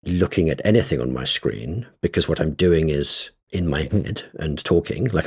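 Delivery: normal talking voice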